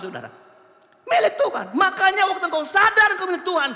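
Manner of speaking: high, loud pulpit exhortation; fired-up sermon, pitch held high; full-force preaching. Real, not acted. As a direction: drive it home to the congregation.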